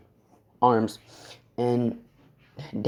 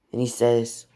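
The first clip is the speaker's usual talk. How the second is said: usual speaking voice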